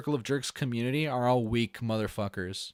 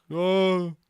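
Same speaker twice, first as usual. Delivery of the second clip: mocking critic voice; 4 semitones higher, slower than usual. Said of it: "they will be like".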